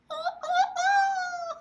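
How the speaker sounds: weak, half-laughing wolf howl; a sad little mock howl at the night. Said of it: "That is the saddest wolf howl ever".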